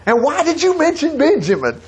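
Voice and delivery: high-pitched, incredulous mock scolding; scolding father. Father getting on to his sons for saying too much, scolding. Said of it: "Daddy's gonna get on to him".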